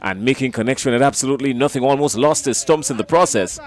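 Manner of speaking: brisk, tense play-by-play; live commentary. Real, not acted. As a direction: call the closing overs with rising drama.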